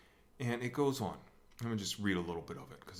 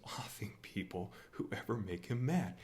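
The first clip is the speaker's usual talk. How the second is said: normal talking voice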